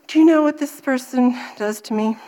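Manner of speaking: ordinary talk, nothing marked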